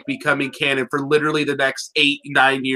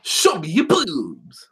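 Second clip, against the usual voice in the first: goofy Captain Falcon; slower than usual. Retold or imitated. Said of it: "I make him sound like a total goober".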